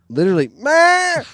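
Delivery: a sudden high, loud nasal bleat to stop the deer; loud bleat call. Retold or imitated. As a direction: blurt out a sharp high "meh!" call. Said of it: "mehing so lar-, loud in my life".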